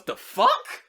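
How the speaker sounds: high, loud shocked outburst; mock girlfriend blindsided by his confession; shocked girl. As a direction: shriek it in disbelief. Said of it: "And she goes".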